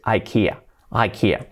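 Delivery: IKEA said "eye-KEE-uh"; English "ai-KI-a" for ikea; English pronunciation. Shown as a demonstration. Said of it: "Nada de ikea".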